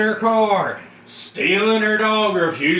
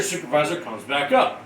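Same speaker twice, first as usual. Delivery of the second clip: usual speaking voice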